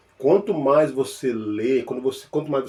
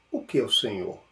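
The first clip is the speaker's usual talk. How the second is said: pondering question; 5 semitones lower, 6 dB quieter than usual. Shown as a demonstration. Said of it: "Aí você começa".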